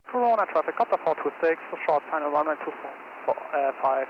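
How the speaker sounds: quiet radio transmission voice; radio call. Shown as a demonstration. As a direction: read it like a pilot on the radio.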